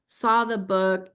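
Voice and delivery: ordinary delivery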